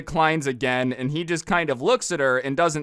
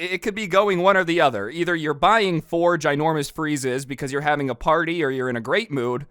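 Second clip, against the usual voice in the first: plain reading voice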